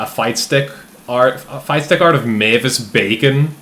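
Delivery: ordinary talk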